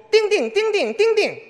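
put-on child babbling. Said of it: "孩子说".

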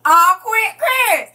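loud, high playful protest; high-pitched mock telling-off; mock scolding. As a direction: shoo away a teasing compliment, loudly.

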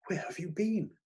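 high, hushed, frightened question; lightly acted fright, pitched high. A narrator's reading. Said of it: "she asked in a frightened whisper".

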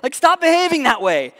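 loud, high exasperated shout; acted outburst at someone behaving badly; outraged scolding. Christians watching other Christians misbehave, loud scandalised shout. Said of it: "when we see other Christians".

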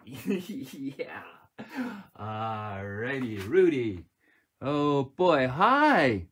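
normal talking voice

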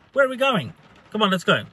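high-pitched, mock-eager question; smart-ass. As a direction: play the cheeky smart ass, pitch jumping up. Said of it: "being a bit of a smart ass".

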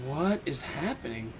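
soft, slow confused question; hushed and slow. Real, not acted. Player stunned after escaping, soft slow murmur. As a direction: ask it slowly under your breath.

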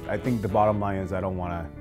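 ordinary talk

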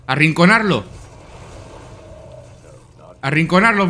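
ordinary talk, nothing marked

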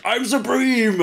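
high, bombastic villain cry; villain shout. Acted. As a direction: bellow it like a mad wizard. Gelt's battle cry in a story.

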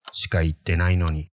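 ordinary delivery

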